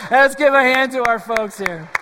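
laughing call for applause. Real, not acted. Pastor asking the congregation to applaud the kids, laughing. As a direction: cheer them on while laughing.

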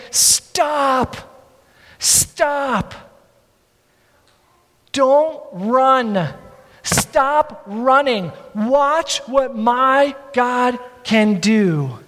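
slow, emphatic command; Moses halting the crowd. Acted. Moses stopping a panicking crowd, slow and commanding. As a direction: give each word its own weight, long pauses between. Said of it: "Moses is saying".

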